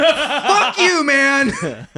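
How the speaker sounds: high, laughing outburst; laughing mock insult. Joking at the gif creator, laughing mock outrage.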